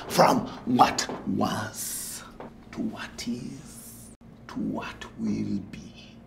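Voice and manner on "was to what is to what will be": soft, breath-like trailing phrases; fading hush. Acted. Guru musing on time's flow, fading hush. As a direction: let it sink toward a whisper.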